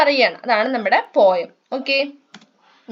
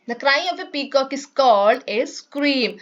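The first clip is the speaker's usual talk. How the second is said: slow, careful textbook reading; reading aloud; 2 semitones higher, much slower than usual. Shown as a demonstration. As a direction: read each word out slowly for the class. Teacher reads textbook English slowly.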